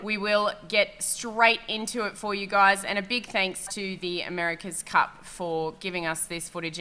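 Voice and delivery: usual speaking voice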